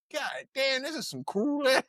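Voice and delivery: high, soft mock whine; squeaky under-the-breath protest, breaking into a laugh; retold dismay. Kid reacting to getting roasted at school, in mock dismay.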